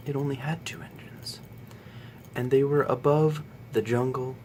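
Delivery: spooky bedtime story. Acted. Reading viewers a bedtime story in the dark, hushed and spooky. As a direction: tell it like a ghost story by candlelight.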